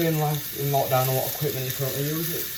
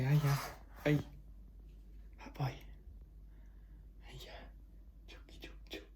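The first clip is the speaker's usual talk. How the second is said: soft whispered cooing; calling chickens; 2 semitones higher, 14 dB quieter than usual. Real, not acted. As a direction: coo to him under your breath. Greeting pet quietly.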